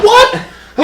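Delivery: high, loud, sudden startled yelp; reliving the shock of hearing the name; stunned disbelief. Retold or imitated. Retelling his reaction to the fighter's name, stunned. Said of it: "I was like".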